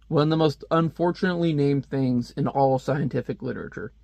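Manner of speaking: ordinary delivery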